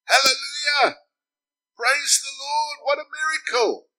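high, loud joyful cries of praise; ecstatic shout. Retold or imitated. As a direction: shout it out like an overjoyed hippie. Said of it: "Why are you so excited?".

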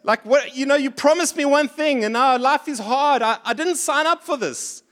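I didn't sign up for this, hammed up; a hint of sulky grievance; indignant complaint. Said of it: "as someone once said".

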